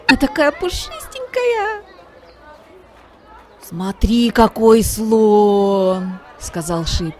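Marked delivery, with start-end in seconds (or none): 0.1-1.8 much higher cooing voice; gushing over a fluffy monkey; squeaky kitten. A narrator's reading. 3.6-6.2 louder, very slow, drawn-out; a big awed boy kitten. A narrator's reading. Ship admires elephant.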